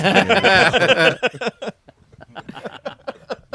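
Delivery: big high burst of laughter; loud laughter. Real, not acted. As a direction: cracks up loudly.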